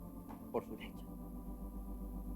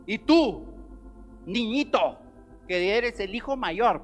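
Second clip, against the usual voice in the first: preaching at full force; 8 semitones higher, 10 dB louder, slower than usual. Acted.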